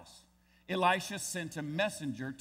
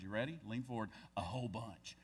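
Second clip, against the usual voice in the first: softer, conspiratorial tease to the congregation; hushed punchline; 6 semitones lower, 9 dB quieter, faster than usual. Pretend. Pastor hushing the room for a joke, much softer. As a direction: drop the voice way down, leaning in like a secret.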